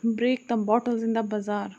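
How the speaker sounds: ordinary delivery, nothing marked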